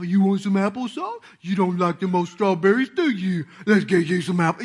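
lightly hammed-up doting parent; goofy parent talk. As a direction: spoil the toddler, sweet-talking. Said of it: "You just talk goofy stuff".

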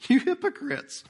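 mock rebuke, laughing. Jesus rebuking the synagogue leader, said through a laugh. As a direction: toss it out with a laugh of delight. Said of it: "the Lord replied".

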